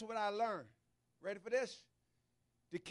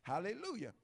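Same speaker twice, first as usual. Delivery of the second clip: normal talking voice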